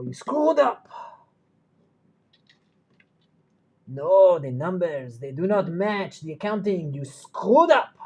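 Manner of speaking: loud, high mock yell of a French professor; imitating the shouting professor. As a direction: bellow it like an exasperated accountant teacher. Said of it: "he used to go".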